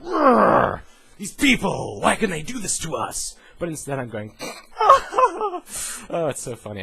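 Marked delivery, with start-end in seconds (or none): 0.0-3.4 loud, high hammed-up roar; mock outrage. Angry Guild Wars player raging at the company, loud mock roar. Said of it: "I'd be like". 4.8-6.8 fake laugh. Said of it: "I'm doing a fake laugh".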